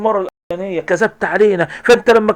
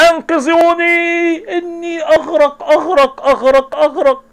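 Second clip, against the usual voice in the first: mock drowning cries; 10 semitones higher, slower than usual. [name] acting drowning, calling out.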